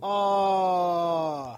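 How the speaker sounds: loud high mock sob; hammed-up fake wail; fake crying. Mock grief at GamePro closing.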